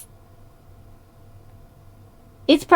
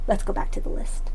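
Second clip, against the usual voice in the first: whisper; 6 dB quieter than usual. Shown as a demonstration. Repeats the line, then whispers it.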